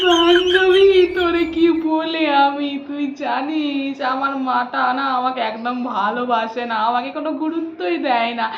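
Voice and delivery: low, slow hurt confiding; elder sister telling her friend mother doesn't love her; sad complaint. Acted. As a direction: pour out her hurt slowly.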